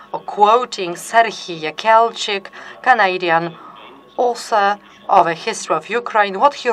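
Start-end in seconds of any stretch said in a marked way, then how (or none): none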